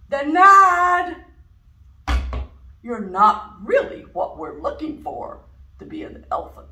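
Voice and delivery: booming loud rejection; loud denial. Acted. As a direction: turn him down loudly. Elephant rejects duck.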